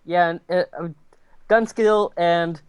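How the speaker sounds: ordinary delivery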